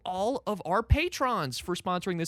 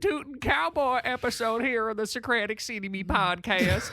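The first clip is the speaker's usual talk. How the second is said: put-on drunk cowboy voice; 7 semitones higher than usual. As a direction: play a tipsy rootin' tootin' cowboy host. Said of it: "I sound like the drunkest cowboy".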